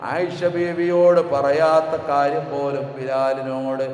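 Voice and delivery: loud, slow sung sermon chant; fervent melodic preaching with recited verses; preaching at full force. Acted. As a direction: pour out the story as a rising chant.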